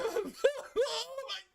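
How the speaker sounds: very high, dragged-out whine broken by laughter; laughing through a high, drawn-out dog whine; whining pug voice. Retold or imitated. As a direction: whine like a hard-done-by pug. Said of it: "he'll whine, he's like".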